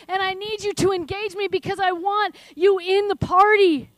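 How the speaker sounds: rising into loud, urgent pleading; prophetic voice of God. Acted. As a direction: speak as God calling his children closer, building to a shout. Speaks as God to listeners.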